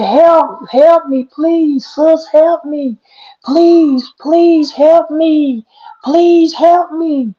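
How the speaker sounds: high, slow, begging cries; desperate pleading. A narrator's reading. Girl running in the woods begging for help, high slow pleading. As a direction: plead for your life, drawing each word out.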